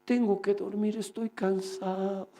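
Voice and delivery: slow, drawn-out tired complaint; put-on weariness. Talking so Satan will hear how tired he is, weary. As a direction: drag it out as if worn out. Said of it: "y hablando así".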